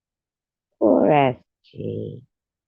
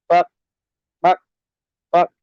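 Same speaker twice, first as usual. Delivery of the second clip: chicken clucking; 6 semitones higher, 4 dB louder than usual. Retold or imitated. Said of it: "comes up to the librarian".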